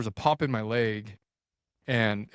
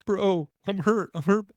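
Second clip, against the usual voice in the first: mock pained plea; 8 semitones higher than usual. Injured hunter telling buddy he's hurt.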